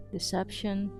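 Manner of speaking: usual speaking voice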